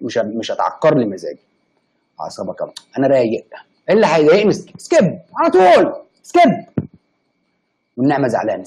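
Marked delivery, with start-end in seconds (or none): 4.5-6.9 very high, loud, drawn-out سكيب; shouted skip. Real, not acted. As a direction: yell سكيب slowly like a cry of frustration. Shouting skip at annoying comment.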